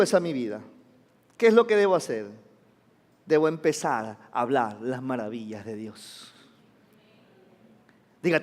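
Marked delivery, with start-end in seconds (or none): 3.2-6.3 low, soft, measured declaration; hushed resolve. Acted. Preacher models a believer's answer, hushed resolve. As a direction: say it softly, like a private resolve.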